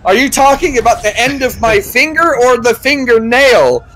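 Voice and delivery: mock-exasperated questioning; acted exasperation.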